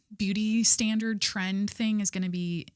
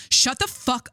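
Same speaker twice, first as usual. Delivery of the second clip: louder stern bark, cut short; a half-hearted angry snap; angry attempt; 5 semitones higher, 5 dB louder, slower than usual. Shown as a demonstration. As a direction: try to sound angry and fail. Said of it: "It should have been angry".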